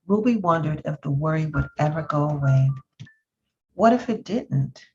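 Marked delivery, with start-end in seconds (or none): none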